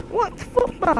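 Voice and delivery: high, bewildered What football; childish high question. Retold or imitated. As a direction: squeak it out like a clueless six-year-old. Confused kid asks about football.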